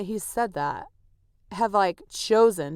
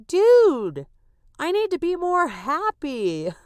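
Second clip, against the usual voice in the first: mock realization; 8 semitones higher, 6 dB louder, slower than usual. Acting out her own loud realization.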